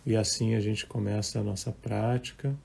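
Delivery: calm, measured meditation voice with long pauses; guided meditation. Acted. Leads a live guided meditation. (voz calma de meditação guiada)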